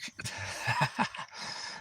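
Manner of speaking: breathy, rapid chuckle; amused laugh. Real, not acted. Amused by the pro wrestling question, breathy laugh. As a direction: laugh quietly in a run of short breaths.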